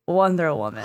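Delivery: low, gently falling confirmation; lightly voiced charmer, quietly certain; imitated island-breeze voice. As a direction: state it low and easy, like an answer. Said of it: "a voice that sounds like an island breeze".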